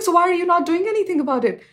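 high, fast, exasperated question; indignant challenge. Retold or imitated. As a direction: push back at the ambassadors, fast and high. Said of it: "And I was like".

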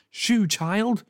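high, sharp shoo; shooing neighbour. A narrator's reading. Neighbour shooing the girl away, sharp high voice. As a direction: wave the child off crossly. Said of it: "said Mister Tash".

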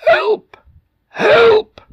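very high, cracked, strained shout; hoarse shouting. Acted. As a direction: shout with a voice almost gone. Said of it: "his voice was almost gone, hoarse from shouting".

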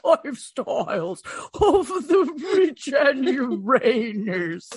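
slow, wailing ghost call; spooky ghost voice. Acted. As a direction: moan it out like a ghost. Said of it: "That's my spooky ghost voice".

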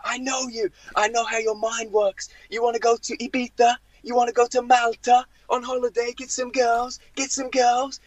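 high-pitched mock accusing rant; seething manager. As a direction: imitate an irate boss who has you figured out. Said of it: "seething, ... breathing fire".

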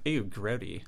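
light California Valley Girl imitation; Valley Girl accent. Shown as a demonstration. Panelist explaining teenage slang to puzzled host, mock Valley Girl accent. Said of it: "California Valley Girl accent".

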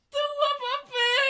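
high, drawn-out mock yell through a sob-laugh; long high mock cry for toilet paper. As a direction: wail it like a brother stranded on the toilet. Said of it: "så hör jag från toan här uppe".

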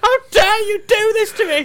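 very high, hammed-up shriek of betrayal; outraged wail. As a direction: wail it at your owner. Dog raging at owner.

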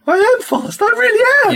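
very high, loud squealing boast; mock boasting shout. Host mimics guitarist proud of his nickname, high mock boasting shout. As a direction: shout it hammed-up, way up in pitch.

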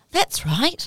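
agree, sounding impressed; impressed agreement. Retold or imitated. Mum agreeing with her daughter, impressed.

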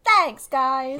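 Am's thanks. Real, not acted. Guest thanks friends praising her podcast, in her friend's pet manner. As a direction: take a compliment in a friend's manner. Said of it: "in the exact same way that Am always responds".

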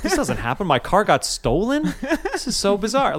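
high, incredulous, laughing through it; half-laughing mock panic; retold disbelief. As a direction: replay the shock of finding the car gone. Retold panic at stolen car.

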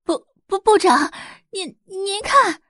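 high, slow, stuttering panic; shocked programmer calling the boss; stammering in shock. A narrator's reading. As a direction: stammer it out, terrified. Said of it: "发出尖叫的是一名程序员".

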